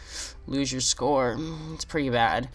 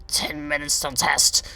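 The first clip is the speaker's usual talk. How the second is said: stern teacher; 3 semitones higher, slower than usual. Retold or imitated. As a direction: clip it like a strict teacher. Teacher repeats refusal.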